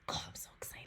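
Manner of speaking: thrilled whisper, barely voiced; excited whisper. Real, not acted. Fan confessing her excitement about the movie, whispering. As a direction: whisper your excitement like a secret.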